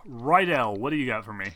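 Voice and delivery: ordinary delivery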